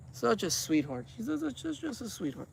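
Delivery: soft cooing over a pet; doting. Real, not acted. Owner petting the sweetheart cat, softly doting. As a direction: drop to a soft, fond murmur.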